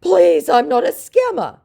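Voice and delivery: very high, loud mock plea; hammed-up high scammer plea. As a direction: whine it as the scammer. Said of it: "said my scammer".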